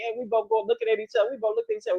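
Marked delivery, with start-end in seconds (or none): none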